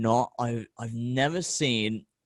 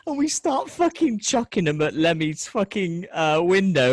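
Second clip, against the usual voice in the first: ordinary talk, nothing marked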